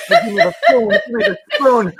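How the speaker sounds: high, loud hammed-up begging; mock frenzied passion. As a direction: beg in a shrieked mock climax. Mock-passionate climax of letter.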